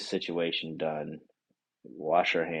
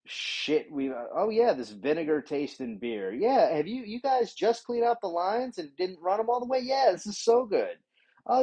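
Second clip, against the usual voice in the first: high, gushing fake delight; sarcastic mock praise; 10 semitones higher than usual. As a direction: gush over the bad beer and cold chicken to mock them.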